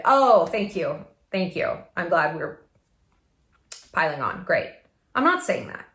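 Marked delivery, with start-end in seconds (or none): 0.0-4.7 sarcastic, dropping away; sarcastic thanks. Acted. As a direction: thank them with dry sarcasm. Sarcastic reply to unhelpful advice.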